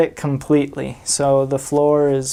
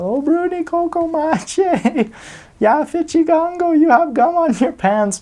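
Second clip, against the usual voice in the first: put-on much higher, childlike sing-song chanting; kids' sing-song taunt. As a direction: mimic the village kids teasing him in a high chant. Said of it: "just yell".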